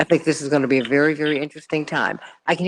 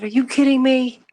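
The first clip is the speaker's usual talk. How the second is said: mother's indignation; 8 semitones higher, 4 dB louder than usual. Retold or imitated. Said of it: "my mother was furious".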